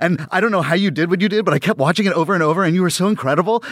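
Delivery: high, fast, breathless gushing praise; gushing fanboy. Retold or imitated. Meeting his hero, gushing fast and high. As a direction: pour out fan praise all at once. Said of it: "I fanboyed out on him".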